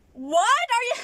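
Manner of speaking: loud, high-pitched frustrated blurt; frustrated outburst. Real, not acted. Vlogger fed up with comments about her eating, loud and frustrated. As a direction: raise your voice sharply in frustration. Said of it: "you can tell by that, the volume of my".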